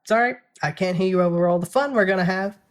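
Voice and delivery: high, loud drowning him out; loud interruption. A narrator's reading. Jenna drowning out her brother, loud and gleeful. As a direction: talk over him cheerfully. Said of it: "she interrupts".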